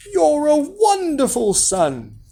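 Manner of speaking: high, slow gushing praise; proud mother's drawn-out delight. A narrator's reading. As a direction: gush over your son, pitched high.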